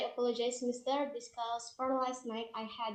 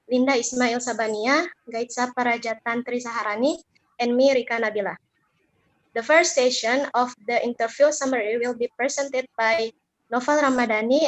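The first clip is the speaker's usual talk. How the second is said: loud, projected presentation voice; student presenter announcing her group to the class, loud and formal; 16 dB louder than usual. Acted. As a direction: address the whole class like opening a formal talk.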